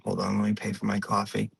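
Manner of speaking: hushed, hurried acted aside; quiet aside. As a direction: drop the voice for a passing aside. Aside in the impersonation.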